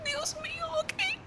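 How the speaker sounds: high, quiet, near-tearful cry; voice breaking. Real, not acted. Overwhelmed reader breaking up over a book. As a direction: voice cracks with emotion over the book.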